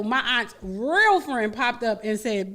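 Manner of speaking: normal talking voice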